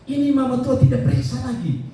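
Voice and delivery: high-pitched, cheerful good-news voice; imitated cheery caller, pitched well up; cheerful phone report. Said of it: "nada di sana tuh nada ceria".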